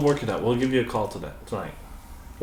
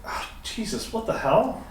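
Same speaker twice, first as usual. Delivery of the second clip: shocked; 12 semitones higher than usual. Acted. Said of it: "It's like".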